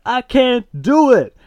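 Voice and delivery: high, loud, slow outburst; acted exasperated refusal. Dad refusing to be compassionate, exasperated outburst. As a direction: wail the refusal high and drawn out.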